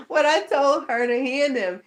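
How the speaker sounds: high laughing voice; words riding on a laugh. Real, not acted. Mother laughing at the staff guy's attitude, high laughing.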